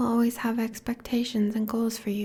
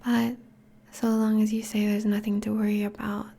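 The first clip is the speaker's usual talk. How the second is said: normal talking voice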